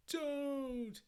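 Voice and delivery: loud, high-pitched cry of "stop"; desperate shout. Acted. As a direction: cry out to halt someone. Acted desperate cry in comic.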